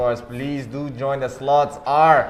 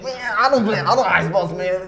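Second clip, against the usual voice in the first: imitating co-caster; 6 semitones higher than usual. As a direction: parody the co-host's complaint. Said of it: "the mouth out your words literally said".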